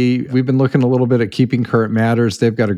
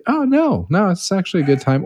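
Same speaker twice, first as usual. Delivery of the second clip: much higher, light mock client reply; client reassuring; 9 semitones higher than usual. The called client reassuring the caller, in a much higher mock voice. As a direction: play the relieved client saying it is fine. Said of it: "to be able to say".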